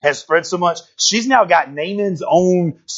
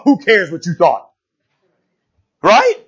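ordinary talk, nothing marked

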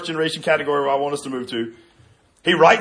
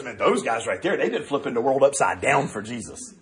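a low, quieter voice for the man in Acts; low bystander voice; 8 semitones lower, 6 dB quieter than usual. Retold or imitated. Preacher voicing a man in Acts, low and hushed. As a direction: drop the voice low and secretive. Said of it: "the guy looks at him and goes".

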